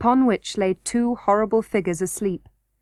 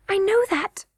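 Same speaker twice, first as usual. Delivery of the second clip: high, lightly hushed princess voice; mild girlish whisper; 11 semitones higher than usual. A narrator's reading. Princess answers Curdie's warning, high and lightly hushed. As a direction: answer as an insistent young girl. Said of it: "whispered Irene".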